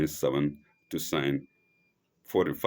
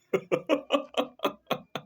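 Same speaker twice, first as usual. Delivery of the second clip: high, staccato run of giggles; amused laugh. Real, not acted. Lecturer amused by the father's threat, real high laugh. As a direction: break into a high chuckle. Laughs at his own paraphrase.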